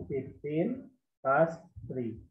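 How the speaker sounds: Fifteen past three drawn out, word by word; very slow model. Shown as a demonstration. Teacher repeats the phrase for the class, very slowly. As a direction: stretch every word for the pupils. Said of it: "Atau bisa juga kita gunakan".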